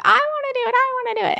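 put-on high pleading little child; whiny kid voice. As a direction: beg like an excited small kid. Child begging grandma to let her.

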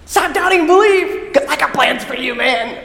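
high, eager urging; bright-eyed enthusiasm, starting high and easing down; excited Jesus. Acted. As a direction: cheer a friend on with big plans for him. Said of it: "Does it sound a lot more like Jesus".

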